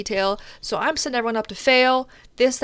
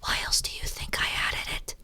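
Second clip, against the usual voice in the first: whispered aside; 8 dB quieter than usual. Retold or imitated. Repeated rant dropped to whisper.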